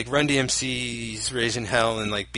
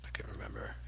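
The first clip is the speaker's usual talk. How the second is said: whisper; 4 semitones lower, 18 dB quieter, faster than usual. Real, not acted. Whispered aside.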